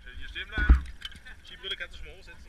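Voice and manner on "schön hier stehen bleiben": calling out. Real, not acted. Calling an instruction to rider.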